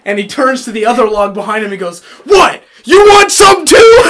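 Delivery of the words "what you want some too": high, loud mock yell at a log; laughing through an imitated shout; retold yell. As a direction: bark it at the log like picking a fight. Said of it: "He yelled at the log?".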